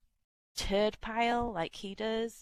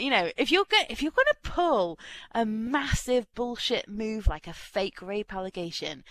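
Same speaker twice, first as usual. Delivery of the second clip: usual speaking voice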